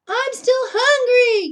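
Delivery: high, loud, slow cry; yell. Acted. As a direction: bellow it out greedily. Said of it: "yelled Bullfrog".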